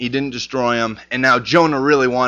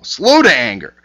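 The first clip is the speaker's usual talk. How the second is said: scripture reading; 4 dB louder than usual. Shown as a demonstration. Reads scripture aloud.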